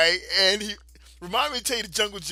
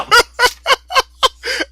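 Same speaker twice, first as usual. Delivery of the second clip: a burst of high loud laughter; 17 dB louder than usual. Real, not acted. Laughing at his own story to a friend, loud high laugh. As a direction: crack up out loud.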